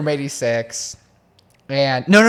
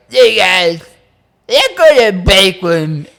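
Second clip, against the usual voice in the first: loud, high put-on voice of a drunk friend; drunk Josh voice; 6 semitones higher, 9 dB louder, slower than usual. Friend imitates drunk Josh inviting the group, loud and high. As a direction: ham up a tipsy buddy calling everyone. Retelling drunk Josh's words.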